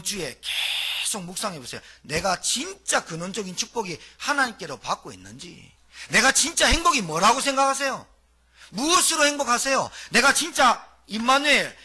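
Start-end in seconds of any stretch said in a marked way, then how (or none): 6.1-11.7 preaching at full force. Acted. Preacher proclaiming identity to the congregation, full force. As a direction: proclaim it to the whole church.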